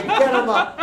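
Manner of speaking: mock heroic command; commanding order. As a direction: bark the order like a triumphant leader. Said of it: "And then I say".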